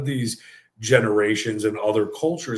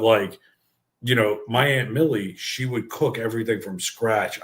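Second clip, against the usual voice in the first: ordinary delivery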